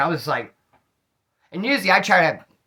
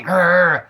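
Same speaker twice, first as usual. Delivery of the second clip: imitated pit bull growl, loud; dog growl; 7 dB louder than usual. Imitating the pit bull on top of him, a loud growl.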